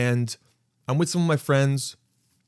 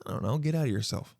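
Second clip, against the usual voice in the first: normal talking voice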